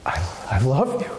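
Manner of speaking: mean every word of it; heartfelt. Shown as a demonstration. To a spouse, saying I love you with tender sincerity.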